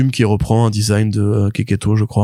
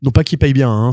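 ordinary delivery

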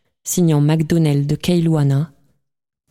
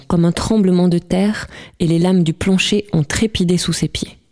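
plain reading voice

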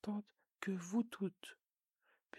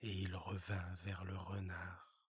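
low hushed storyteller aside; soft low narrator whisper; whispered narration; 13 semitones lower, 6 dB quieter than usual. A narrator's reading. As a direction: hush it like a bedtime narrator. Narrator voice between scenes.

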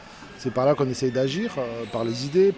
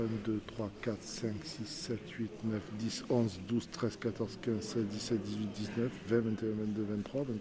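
quiet counting; 9 dB quieter than usual. Real, not acted.

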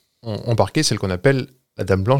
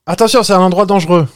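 higher, louder warning shout; mock warning cry; 7 semitones higher, 5 dB louder than usual. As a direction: cry it out like a horror film ghost. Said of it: "faut dire des grosses phrases quand tu cries".

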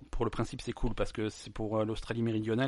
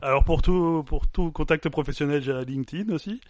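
disguised voice; 3 semitones higher, 8 dB louder than usual. Pretend. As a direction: play the anonymous witness, hammed up a little. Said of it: "Ma, ma voix est modifiée".

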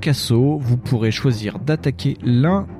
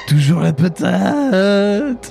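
raspy warlord voice; 9 semitones higher, much slower than usual. Acted. Continues the chief's voice.

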